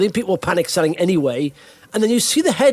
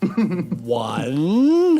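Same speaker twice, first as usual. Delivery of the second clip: slow sloth; 3 semitones lower, much slower than usual. Retold or imitated. Sloth voice, far.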